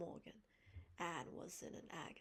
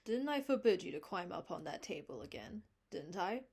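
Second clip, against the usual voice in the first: stern scolding; 9 dB louder than usual. Acted. Marilla scolds Davy.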